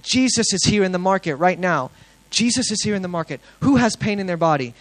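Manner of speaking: high, loud proclaiming; open-air preaching. Retold or imitated. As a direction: call out to the whole market. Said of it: "I should open air preach".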